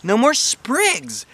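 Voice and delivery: amazed at her new hair; real delighted. A narrator's reading. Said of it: "I said, real delighted".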